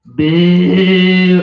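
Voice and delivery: long, loud drawn-out boo; heckler's sustained, imitated boo; old lady booing. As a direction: boo loudly, stretching the vowel right out. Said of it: "started booing me, just going".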